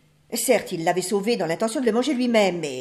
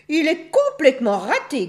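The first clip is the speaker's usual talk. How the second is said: loud, raised, disgusted verdict; disgusted scolding; 7 semitones higher, 6 dB louder than usual. A narrator's reading. Aunt wolf rejecting the cub, disgusted scolding. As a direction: condemn the cub loudly with disgust. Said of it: "gronda tante louve, dégoûtée".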